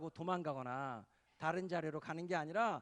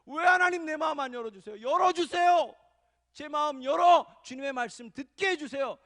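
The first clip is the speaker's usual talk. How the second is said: very loud, high, desperate cry; full-force shouted prayer, crying out to be heard; wailing plea to God; 8 semitones higher, 13 dB louder than usual. Shown as a demonstration. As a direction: beg God at the top of your voice. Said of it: "이렇게 울부짖어야".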